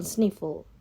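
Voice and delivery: usual speaking voice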